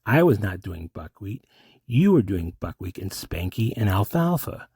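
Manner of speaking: normal talking voice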